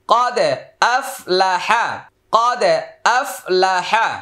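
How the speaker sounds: Quranic spelling drill. Shown as a demonstration. Teacher modelling Arabic syllables for students to repeat, measured drill chant. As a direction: spell it out, then sound it in a lilting model voice.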